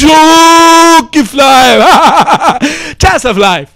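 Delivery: long hammed-up laughing yell; drawn-out laughing shout. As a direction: stretch it out through laughter.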